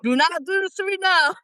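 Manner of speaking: high, pleading to herself; begging her nerves to settle; nervous plea. Retold or imitated. Retelling her nerves at the audition, high mock plea. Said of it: "I'm like".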